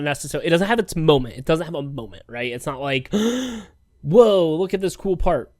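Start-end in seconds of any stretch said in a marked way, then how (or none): 3.1-5.5 mock wowed. Mocking an over-hyped moment.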